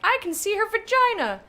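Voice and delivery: high, loud mocking voice; mock quote. Streamer mocking how people say it, high and loud. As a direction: mimic people saying it. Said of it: "They're like".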